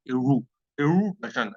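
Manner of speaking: slow, drawn-out एडू, एडू थचन with each syllable spelled out; pronunciation demo. Shown as a demonstration. Teacher slowly sounds out एडू थचन for the class. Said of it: "ऐसे, ऐसे कुछ बोला जाता है".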